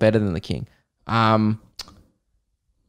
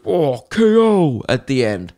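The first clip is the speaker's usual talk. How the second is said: game announcer. Retold or imitated. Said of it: "it goes".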